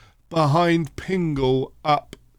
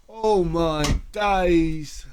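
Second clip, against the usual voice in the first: drawn out in disbelief; a slow, amazed groan at the miss; stunned disbelief at the post; slow stunned "oh my days"; much slower than usual. Real, not acted. Shot hits the post.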